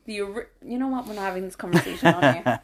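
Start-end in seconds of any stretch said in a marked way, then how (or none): 1.0-2.6 laughing. Real, not acted. Laughing, cutting off the argument.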